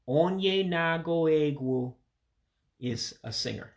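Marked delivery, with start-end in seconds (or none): 0.1-1.9 "onye nago egwu" slow, each word apart; slow breakdown. Shown as a demonstration. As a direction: spell out "onye nago egwu" slowly for learners. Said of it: "So again".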